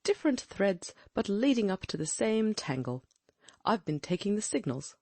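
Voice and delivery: alert, intent gentleman detective; keen detective voice. Acted. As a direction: voice a sharp, watchful sleuth. Holmes voiced in a reading.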